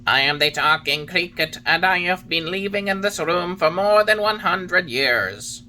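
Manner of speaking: Talking Cricket. A narrator's reading. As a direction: voice the old cricket, stern and knowing. Cricket introduces himself.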